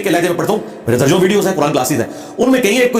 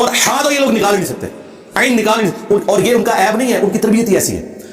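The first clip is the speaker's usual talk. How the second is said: normal talking voice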